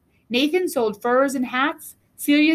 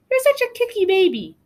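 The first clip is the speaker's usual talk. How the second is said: playful big sister; 7 semitones higher than usual. Acted. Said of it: "she said".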